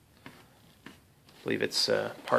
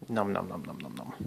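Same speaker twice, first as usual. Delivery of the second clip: mock munching; 5 semitones lower, 9 dB quieter than usual. Mock eating noise while painting.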